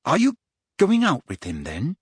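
high, eager question, falling away at the end; wide-eyed. A narrator's reading. Parvati asking Hermione about Cormac, wide-eyed. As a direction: ask with wide-eyed curiosity. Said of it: "wide-eyed".

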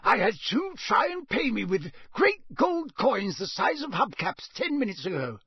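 put-on a wary old Scots countryman; rustic campsite owner. Campsite owner voiced by narrator.

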